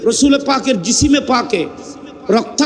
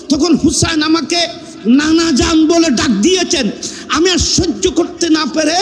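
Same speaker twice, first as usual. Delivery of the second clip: impassioned melodic preaching; 5 semitones higher than usual. Acted. Preacher builds to climax.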